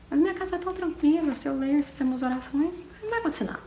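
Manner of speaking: high-pitched mock innocence; teasing, put-on high voice; joking. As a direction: pretend to reason playfully, pitched well up.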